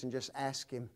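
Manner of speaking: ordinary talk, nothing marked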